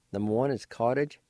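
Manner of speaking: ordinary talk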